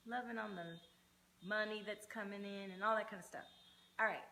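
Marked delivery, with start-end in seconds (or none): none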